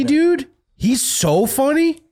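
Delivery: high, slow gushing praise; excited. Real, not acted. Repeats praise of Colbert.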